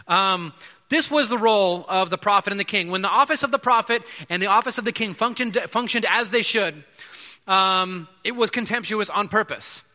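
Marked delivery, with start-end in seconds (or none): none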